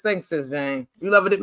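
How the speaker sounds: ordinary talk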